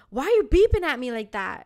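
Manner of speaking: retold, affronted question; indignant complaint. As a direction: imitate an annoyed passenger challenging the driver. Retold complaint to the bus driver.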